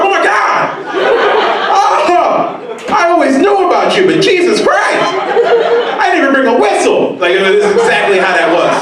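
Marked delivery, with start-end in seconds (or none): 0.0-7.2 way higher, mock astonished squeal at an Oompa Loompa; hammed-up shock, pitched way up; mock amazed shriek. Comic gasping at an Oompa Loompa on the street, high mock squeal. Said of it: "I'd be like".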